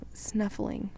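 plain reading voice

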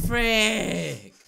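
high, loud drawn-out outburst; frustrated yell. Real, not acted. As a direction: yell it out in real exasperation. Frustrated after failing in game.

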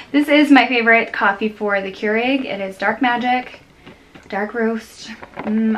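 usual speaking voice

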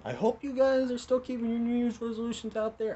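high, loud and bright host call-out; excited. Acted. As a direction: pitch it up like a TV host.

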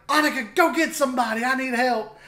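high, urgent acted-out call; calling for help. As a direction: re-enact a high, hurried plea for help. Retelling his cry for help.

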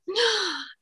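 sharp high gasp of fright; frightened gasp. Acted. Fairy spots the stoat, a frightened gasp. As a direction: gasp in sudden fear. Sees the stoat chasing her.

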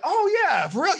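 high, eager echo of a friend; mimicking the other person. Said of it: "You wanna mimic them".

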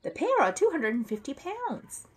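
high-pitched, matter-of-fact warning; high voice, falling firmly at the end; doubtful shop man. Acted. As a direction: inform a customer in a lifted character voice. Crisp states the figure.

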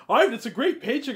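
high, loud, laughing sales pitch to Ivan; Peter pushing the deal. Acted. Peter laughing as he tries to convince Ivan, high and loud. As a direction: oversell the paycheck with a laugh.

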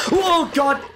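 high, startled yelp; startled cry. Real, not acted. Gamer jumping at an enemy in the game, startled cry. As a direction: cry out as if suddenly ambushed.

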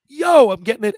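high, loud burst of delight; excited retold outburst. Retells getting the call for the role.

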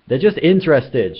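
loud, high-pitched insistence; shout. A narrator's reading. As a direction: shout it over their worries. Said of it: "shouted Zaphod".

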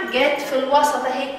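ordinary talk

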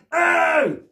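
loud, high "eww" of mock disgust; mock disgusted shout. Storyteller reacting to the land of stinky nappies, mock disgusted shout. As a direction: shout a big hammed-up "oh!".

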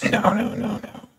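very high, thin voice saying no; the shaming voice in her head, shrill and quick; high mocking voice of shame; nagging inner voice. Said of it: "That voice".

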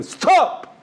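high-pitched shouted command, re-enacted; retold shout. Retelling how he stopped a man beating his wife, shouted. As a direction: yell it to halt an attacker. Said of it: "So I shouted and screamed at him".

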